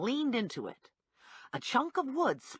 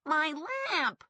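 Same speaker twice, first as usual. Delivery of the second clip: higher, mild mock shriek over the lamp; 7 semitones higher than usual. A narrator's reading. Man seeing his smashed lamp, mild mock shriek. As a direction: wail about the broken lamp, understated. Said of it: "he shrieked".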